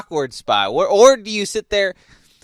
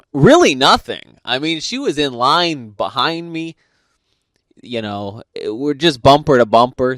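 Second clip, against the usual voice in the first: ordinary talk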